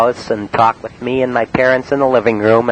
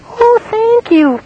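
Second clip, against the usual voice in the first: very high, slow falsetto; a man faking a woman's voice on the phone, high falsetto; high disguise voice; 4 dB louder, much slower than usual. Retold or imitated. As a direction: put on a sweet lady's falsetto to disguise yourself. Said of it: "I talked in a high voice".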